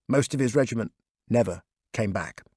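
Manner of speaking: usual speaking voice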